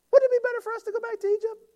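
very high, fast silly whine; cartoonish whining complainer; squeaky mock whine. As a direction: exaggerate the grumble into a squeak. Repeat in exaggerated voice.

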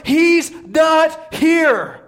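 high, very slow shout, each word held; triumphant proclamation. Real, not acted. Preacher to congregation on the resurrection, triumphant proclamation.

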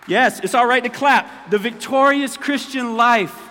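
loud, high, slow proclamation; fired-up preaching shout. Acted. Pastor urging the church to clap, shouting. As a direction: shout it over the applause.

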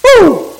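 sharp high whoop of excitement; preacher's whoop. Real, not acted. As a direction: let out a joyful woo. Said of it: "That was good right there".

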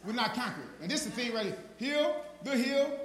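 high, loud shouted sermon; preaching shout. Acted. Preacher defying the devil before his congregation, shouting. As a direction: thunder it at the devil.

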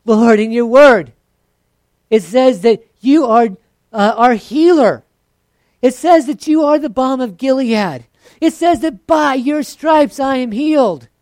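loud, high reciting of scripture; quotation prayer. Retold or imitated. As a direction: quote the verses at God. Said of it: "You have the quotation prayer".

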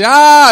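the wife screaming as the car flies down the road; panicked scream. Retold or imitated. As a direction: imitate his wife shrieking in the car. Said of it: "She was freaking out".

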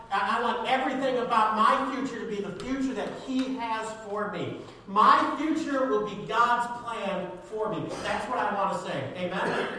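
high, loud, forceful declaration; impassioned preaching. Real, not acted. Preacher declaring his future belongs to God, impassioned. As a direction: build to an emphatic proclamation.

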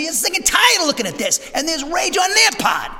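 loud, high preaching shout; fiery shout. Real, not acted. Preacher shouting about the country's anger. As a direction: shout it out with fire.

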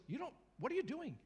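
high, sharp nagging inner voice; high startled scolding conscience. Acted. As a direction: play the conscience catching you out.

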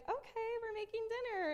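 high, singsong child imitation; compliant kids. Kids agreeing to mom's dinner plan, high-voiced. Kids cheerfully going along.

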